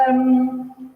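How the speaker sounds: ordinary talk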